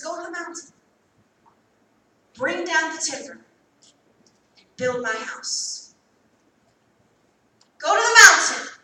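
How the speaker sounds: commanding sermon cadence, slowing on build; preaching God's command. Acted. Preacher to congregation, proclaiming God's command. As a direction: proclaim it like the Lord's order. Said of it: "I'm gonna say it till you feel it".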